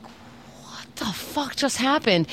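slow, hushed, stunned; stunned disbelief. Retold or imitated. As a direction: drag it out, baffled and quiet. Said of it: "And I'm like".

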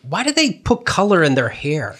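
high-pitched retold question; mom's curious question. Mom asks daughter about teenagers' dyed hair, curious and high.

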